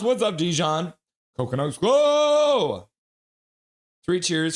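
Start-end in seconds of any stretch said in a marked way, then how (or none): 1.4-2.9 high, slow, drawn-out cheer; excited cheer. Real, not acted. Cheering a chat regular in a high, drawn-out call. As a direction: stretch it out like a hype call.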